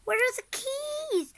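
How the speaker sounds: high, loud frantic demand; a flustered mom hunting in a panic. A narrator's reading. Frantic mom asking where the keys are, high and frantic. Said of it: "she was frantic".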